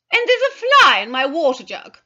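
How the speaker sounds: loud, fretful whine; fretful complaint. Acted. Mrs Carter griping to hospital staff, fretful and loud. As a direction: complain peevishly from the sickbed. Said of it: "she said fretfully".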